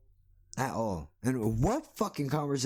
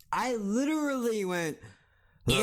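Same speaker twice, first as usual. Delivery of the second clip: exasperated groan; 10 semitones higher than usual. Retold or imitated.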